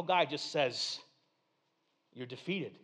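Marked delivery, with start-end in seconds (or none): 2.1-2.8 soft, low and matter-of-fact; quiet power, no show at all; understated pronouncement. Acted. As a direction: drop it softly and low. Understated contrast to flashy movie.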